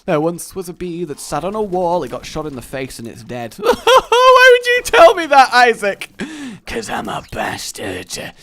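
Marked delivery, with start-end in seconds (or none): 3.6-6.1 high, loud hammed-up wail; mock sobbing. Listener hearing Isaac's sad story, mock crying. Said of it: "sad story that makes you start sniffling". 6.7-8.3 slow, lower Scottish villain answer; Scottish accent. Acted. Isaac admitting his cruelty, in Scottish accent. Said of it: "why he went Scottish for that".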